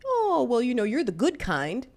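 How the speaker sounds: high, sugary mock politeness; imitating a falsely kind stranger. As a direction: coo it like a condescending stranger. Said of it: "if they say it nicely".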